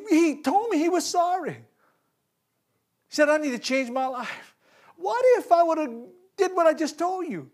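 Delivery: impassioned high-pitched regret; anguished preaching. Real, not acted. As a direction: cry out how close he came to failing.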